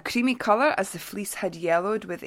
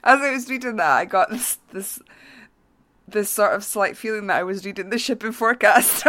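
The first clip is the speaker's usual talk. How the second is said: talking through giggles, louder; barely holding it together; giggly confession; laughing explanation; 3 semitones higher, 7 dB louder, faster than usual. Real, not acted. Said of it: "That was so funny".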